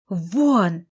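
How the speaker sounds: one loud barked word; sharp loud bark. A narrator's reading. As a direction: bark it like a command. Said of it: "рявкнула".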